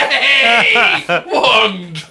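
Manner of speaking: high, loud cheer through laughter; mock cheer. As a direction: whoop it out, laughing. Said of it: "he was like".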